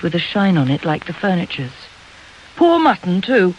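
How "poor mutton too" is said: high swooping complaint; plaintive mother.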